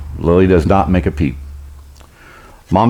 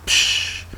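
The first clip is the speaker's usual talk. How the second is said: imitated water splash; quiet voiceless whoosh of spray; splash noise; 8 dB quieter than usual. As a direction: make a soft splash sound. Imitates the log ride splash.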